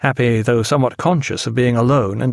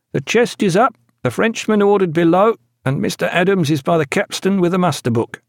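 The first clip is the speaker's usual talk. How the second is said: high-pitched dutiful report; high naval officer's report; 7 semitones higher than usual. A narrator's reading. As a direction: report smartly to the captain in a raised voice. Officer reporting to captain on deck.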